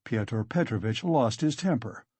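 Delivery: usual speaking voice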